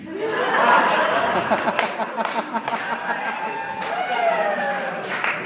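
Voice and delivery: long, high, loud laughing fit; hearty real laughter; big laugh. Real, not acted. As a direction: burst out laughing at the punchline. Laughing at own story.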